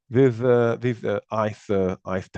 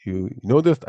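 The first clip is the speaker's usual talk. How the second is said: ordinary talk, nothing marked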